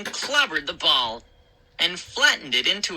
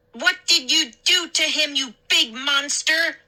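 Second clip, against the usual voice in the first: shrieked; 9 semitones higher than usual. A narrator's reading. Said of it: "Mindy shrieked".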